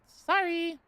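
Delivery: high, sing-song "sorry"; mock apology. Real, not acted. Refusing the draft, high-pitched mock apology. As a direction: toss out a high, unrepentant sorry.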